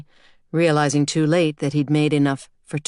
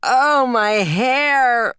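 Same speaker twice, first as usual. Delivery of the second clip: long, high, loud drawn-out wail; groaned dismay; 9 semitones higher, 5 dB louder, much slower than usual. A narrator's reading. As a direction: groan in horror at the mirror, stretching it out. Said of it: "she groaned".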